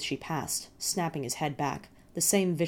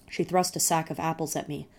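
plain reading voice

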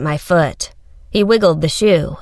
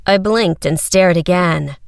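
plain reading voice

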